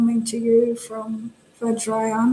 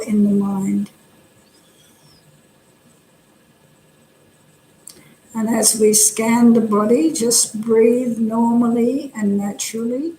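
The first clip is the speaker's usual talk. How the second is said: calm, unhurried instruction with pauses between phrases; guided meditation. Real, not acted. Meditation teacher helping listeners settle the body, calm guidance. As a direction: settle the listeners gently into the practice.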